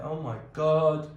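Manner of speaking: swept-up exclamation; overwhelmed oh my God. Retold or imitated. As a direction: blurt it out like the show just got to you. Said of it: "and I'm like".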